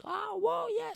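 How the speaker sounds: high falsetto mock scream of delight; squealed, thin mock scream; imitated screaming. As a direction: imitate a girl whooping on a thrill ride.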